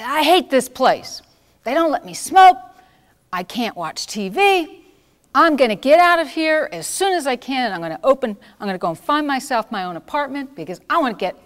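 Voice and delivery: loud, high, furious complaining; angry yelling. Retold or imitated. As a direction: yell it out like a bitter patient who hates the place. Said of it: "she would yell and scream".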